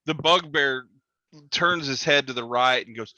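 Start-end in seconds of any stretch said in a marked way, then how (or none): none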